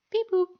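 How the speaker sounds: high, loud robotic beep boop. Retold or imitated. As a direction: chirp it like a little robot. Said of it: "I love how he says beep boop".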